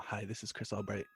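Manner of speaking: ordinary talk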